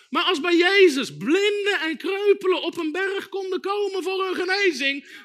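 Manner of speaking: loud, high preaching that builds; fervent challenge. Real, not acted. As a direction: drive the challenge home at the top of your voice. Preacher's climactic challenge to listeners.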